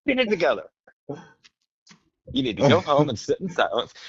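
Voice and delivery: high, lecturing mock guru voice; pushy life coach. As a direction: scold a coworker to meditate. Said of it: "walk up to somebody and then be like".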